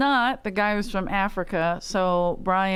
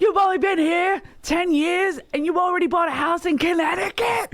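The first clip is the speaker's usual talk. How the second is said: higher, mock incredulous yell; imitated shouting; 9 semitones higher than usual. Retelling Brian grilling the driver in a mock yell. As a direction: retell the question as a half-shout. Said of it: "He was screaming at him".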